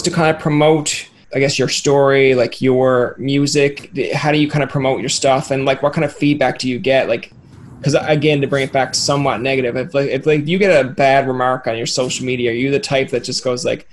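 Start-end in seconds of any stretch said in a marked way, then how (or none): none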